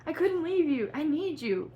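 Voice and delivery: high, loud desperate plea. A narrator's reading. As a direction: beg him desperately. Desperate declaration read dramatically.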